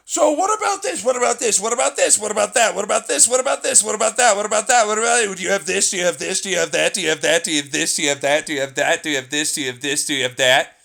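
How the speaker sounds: high, loud nagging mimicry of a pushy customer; mocking pestering customer. Mocking the customer who kept asking, high and loud. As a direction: rattle off the questions in a whiny, shouted mock voice. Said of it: "All because you were like".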